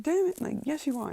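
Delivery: high-pitched imitated whine of frustration; exasperated squeaky insistence; mock protest. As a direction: argue back in a put-on high voice. Acting out her protesting argument.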